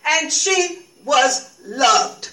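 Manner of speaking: very slow, proclaimed declaration; trumpeted. Acted. Pilate proclaims to the whole chapel, very slow and ringing. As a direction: trumpet it so the sky hears. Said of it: "Pilate trumpeted for the sky itself to hear".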